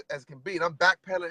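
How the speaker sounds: normal talking voice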